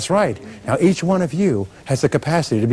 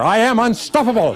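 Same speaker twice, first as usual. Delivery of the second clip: much higher, ringing declaration; even stronger conviction; 10 semitones higher, 3 dB louder than usual. Shown as a demonstration. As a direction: call it out with total belief. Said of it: "Once more".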